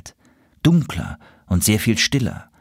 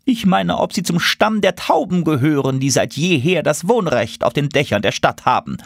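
high, officious bird voice; pompous pigeon; 8 semitones higher than usual. A narrator's reading. Pigeon lecturing the newcomer on roof rights, officious and high. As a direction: lay down the rules from above.

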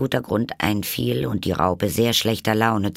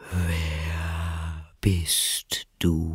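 sleepy, slow caterpillar; 2 semitones lower, 3 dB quieter, much slower than usual. A narrator's reading. The caterpillar sleepily asks Alice who she is. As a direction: let each word sag with sleep. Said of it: "mit schläfriger, langsamer Stimme".

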